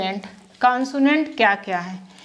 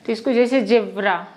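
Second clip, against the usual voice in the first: usual speaking voice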